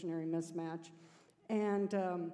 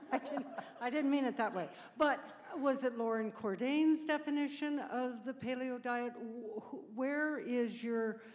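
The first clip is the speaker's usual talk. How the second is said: usual speaking voice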